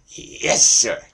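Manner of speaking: loud sidekick affirmation. Shown as a demonstration. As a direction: boom the agreement back to the host. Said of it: "hey, Ed".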